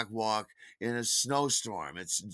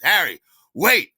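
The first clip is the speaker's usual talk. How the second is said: loud, high call to stop; 9 semitones higher, 14 dB louder than usual. Retold or imitated. Gregory stops Harry as he is leaving, calling out. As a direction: act out calling someone back urgently.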